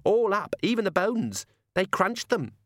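put-on high-pitched child savouring the gore; small girl, approving. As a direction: relish it like a little girl. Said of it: "said a small girl with obvious approval".

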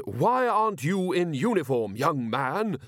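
a hint of a haughty matron scolding; imperious old lady. Elderly lady scolding Nobby for not wearing uniform, imperious. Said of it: "an imperious voice said".

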